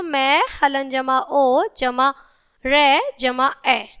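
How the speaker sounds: slow, syllable by syllable; slow spelling out. Shown as a demonstration. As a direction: spell it out slowly for children. Teacher spells out letter by letter. (धीरे-धीरे अक्षर जोड़कर बोलना)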